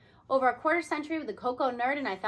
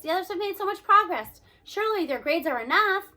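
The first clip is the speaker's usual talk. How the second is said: louder, higher, pleading the case; insisting; 6 semitones higher, 6 dB louder than usual. Acted. Teacher defending his students, raised and insistent. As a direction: refuse to accept it, press hard. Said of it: "I insist".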